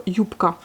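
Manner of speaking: "youpka" with the B said as a soft P; devoiced B in youpka. Shown as a demonstration. Teacher to French learners, B devoiced to P. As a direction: youpka said with a P sound in place of B. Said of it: "la consonne devient un peu sourde".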